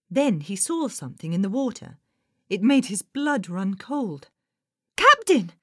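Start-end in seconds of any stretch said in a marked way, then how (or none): 5.0-5.5 put-on high, loud boyish call; startled high-pitched cry; alarmed cry. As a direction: shout for the captain in sudden fright. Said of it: "Nate cried".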